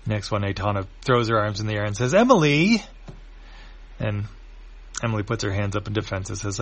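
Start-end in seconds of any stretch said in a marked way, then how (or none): 2.1-2.8 high, loud exasperated outburst; exasperated cry. Acted. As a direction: cry her name in exasperation. Aitana throws arms in air.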